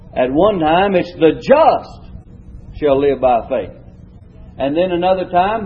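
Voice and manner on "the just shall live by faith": "just" leaned on, the whole line slowed right down; stress on JUST. Shown as a demonstration. Teacher showing the class the first reading, stress on "just". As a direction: dwell on "just" and draw the verse out slowly. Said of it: "each time the emphasis is upon a different word".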